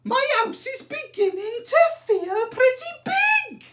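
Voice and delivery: put-on very high, loud relieved old lady. A narrator's reading. Old lady relieved her hen is gone, high and loud. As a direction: sigh it out like a happy little old lady.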